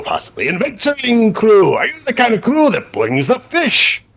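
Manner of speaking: high, exuberant boat character; eager talking boat. Acted. Boat quizzes its captain about the crew, eager high voice. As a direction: swoop up high with delighted curiosity.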